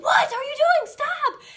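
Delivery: high, very loud startled parent scolding; sudden piercing reprimand; alarmed shout. Retold or imitated. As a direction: shriek it in alarm, as if a child is in danger. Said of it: "my response is always like".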